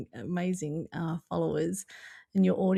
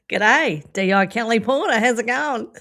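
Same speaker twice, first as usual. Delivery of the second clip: hammed-up Australian greeting; mock introduction in a broad Aussie accent; 2 semitones higher, 6 dB louder than usual. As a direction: play the detective as a jokey Aussie. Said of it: "I read in an Aussie accent".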